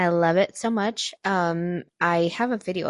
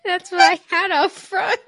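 laughing; 11 semitones higher, 3 dB louder than usual. Real, not acted.

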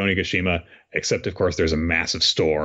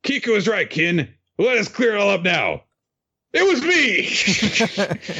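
villain's reveal; 12 semitones higher than usual. Retold or imitated. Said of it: "Kanjuro's like".

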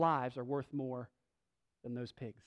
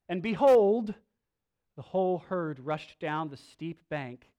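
scripture reading; 3 dB louder than usual. Acted. Reads scripture passage.

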